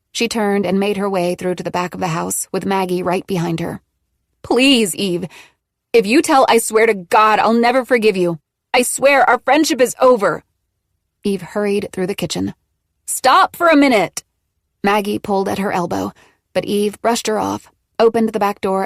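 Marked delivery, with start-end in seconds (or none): 4.4-10.4 desperate pleading. Acted. Maggie begging, threatening. 13.0-14.2 calling out. Acted. Maggie calls after Eve.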